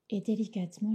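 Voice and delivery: usual speaking voice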